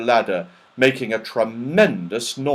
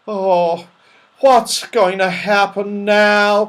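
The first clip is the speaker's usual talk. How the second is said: frightened cry; 8 semitones higher, 5 dB louder, slower than usual. Acted. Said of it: "cried Moonface".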